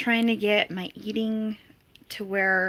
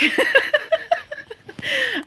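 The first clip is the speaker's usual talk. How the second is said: high-pitched giggle; 3 dB louder than usual. Real, not acted. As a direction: giggle at your own joke. Laughing at own joke.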